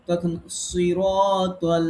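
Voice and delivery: "সিরাতাল" drawn out very slowly with a full, heavy s; heavy sad recitation. Shown as a demonstration. Teacher demonstrating the heavy sad to students, slow recitation. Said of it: "সোয়াদটা আমরা পোর পড়ছি".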